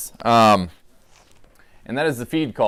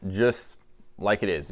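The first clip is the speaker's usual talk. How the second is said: ordinary talk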